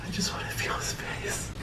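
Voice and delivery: whisper. Pretend. Whispered longing.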